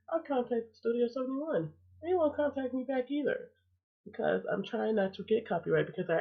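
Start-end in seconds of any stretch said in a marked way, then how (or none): 0.1-3.5 high, exasperated complaint. Real, not acted. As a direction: voice climbs in disbelief. Repeats complaint, higher second take.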